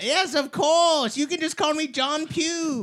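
put-on high, slow posh British accent. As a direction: play a prim British publicist, drawn out. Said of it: "Oh, I'm going British".

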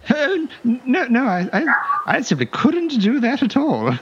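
a vain little dandy clutching his pride; high-pitched affronted fop; pompous fop. Acted. GM voices the pencil-mustache guard.

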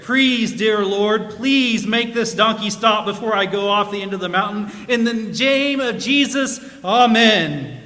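loud, slow, pleading prayer; desperate prayer. A narrator's reading. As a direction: plead with heaven at full voice. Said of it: "out of desperation, the man, uh, prayed".

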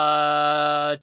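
one long, held Cha on a level note; endlessly stretched first half of a name; drawn-out stall. Retold or imitated. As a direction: hold the vowel far too long, mimicking his stall. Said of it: "takes way too long".

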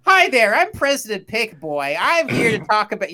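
put-on President Pick Boy. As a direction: pitch it up like a hammy speechmaker. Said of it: "this is my impression of President Pick Boy".

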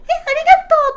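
mock high-pitched thanks. Mocking a too-cute girl receiving cocoa, in a squeaky voice. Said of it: "とかじゃないのがね".

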